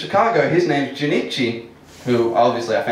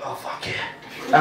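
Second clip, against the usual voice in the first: slow, breathy-whispered leer, imitating a lecherous mate; softly mocked lecherous dickhead, hushed and slow; 6 dB quieter, much slower than usual. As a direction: drag it out voicelessly like a drunk lech. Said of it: "'cause he was lecherous".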